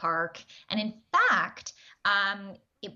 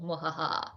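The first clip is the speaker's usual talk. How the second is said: a lightly voiced villain cackle; low mock mwahaha, a touch of villain; evil laugh; 5 semitones lower than usual. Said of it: "she gave a little moo-ha-ha".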